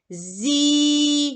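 «Зі» with a long, held і; stretched vowel. Shown as a demonstration. Teacher isolating the second sound for pupils, stretched vowel. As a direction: draw out the vowel in «Зі». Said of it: "Зробимо звуковий аналіз слова зірка".

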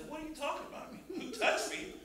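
high-pitched, laughing disbelief; baffled, amused and pitched right up; incredulous disciples. Acted. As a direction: echo the question as if it were absurd. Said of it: "Well, the disciple says".

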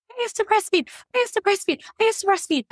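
imitated high, squealed protest; hammed-up high repeated whine; high-pitched wail. Acts out her protest in pain.

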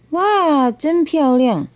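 high, bright gasp of wonder at the view; delighted wonder. A narrator's reading. Turtle wakes and sees the river, high delighted wonder. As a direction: let the wow leap up high.

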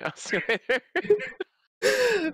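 high laughing goodbye. Real, not acted. Laughing while leaving.

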